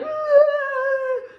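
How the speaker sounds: a high, loud anguished wail; crying wail. Retold or imitated. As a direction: let out a sobbing cry. Crying after the bad cut.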